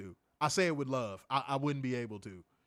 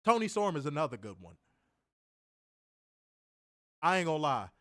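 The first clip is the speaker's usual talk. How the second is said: ordinary talk, nothing marked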